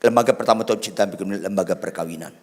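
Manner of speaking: "Lembaga pertama Tuhan ciptakan di bumi" rattled off fast at speaking pitch; fast spoken restatement of "Lembaga pertama Tuhan ciptakan di bumi"; quick repeat. Acted.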